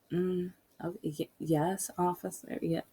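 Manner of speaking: soft stammering yes to the officer; timid reply. Retold or imitated. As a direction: shrink back nervously. Her timid answer to cop.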